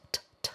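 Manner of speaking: quiet crisp "t, t", clearly released. Shown as a demonstration. Teacher sounding out the British T. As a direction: tap out a sharp T sound. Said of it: "pronounced as t".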